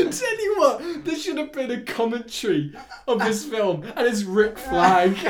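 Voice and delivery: high-pitched, talking through laughter; cracking up while pitching the joke; laughing. Real, not acted.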